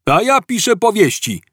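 high-pitched, eager interjection; second cop interrupting. A narrator's reading. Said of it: "wpadł mu w słowo drugi Glina". (policjant wtrącający się wysokim głosem)

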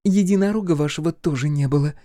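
high-voiced taunt; mocking. A narrator's reading. Centauress to the rescued commander, mocking. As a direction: jeer lightly in a high voice. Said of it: "издевалась кентавриса".

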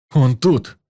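sudden loud burst; exclaiming. A narrator's reading. Vanya hears the voice, loud exclaim. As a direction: blurt it out loud. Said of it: "воскликнул Ваня".

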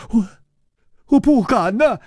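put-on timid young servant. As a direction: falter out the words, ashamed. Yijian answers timidly.

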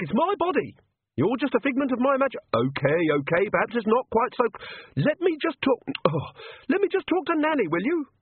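high, flustered pleading; battling a voice in her head; squeaky exasperated protest; frantic inner argument. Acted. Agnes fighting Perdita inside.

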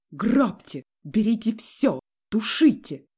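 loud separate bursts of outrage; frantic shout. A narrator's reading. Enraged father offering all his money to the family, frantic shouting. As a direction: cry out each order beside yourself. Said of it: "крикнул он не своим голосом".

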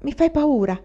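high, loud stammered fright; stammering fear. A narrator's reading. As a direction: stumble over the first word, startled. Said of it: "balbettò Aiwan".

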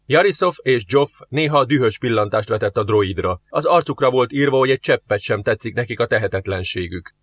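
ordinary delivery

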